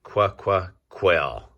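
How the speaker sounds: slow "qua-qua quill" stretched syllable by syllable; sounding out. Shown as a demonstration. Teacher sounds out Q words for children, slow sounding out. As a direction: draw out "qua-qua" before landing "quill". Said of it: "is called a".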